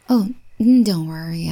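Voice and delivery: normal talking voice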